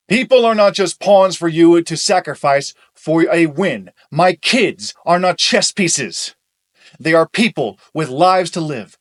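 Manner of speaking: loud, furious; angry shouting. Acted. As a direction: yell it in fury. Heated argument, shouting.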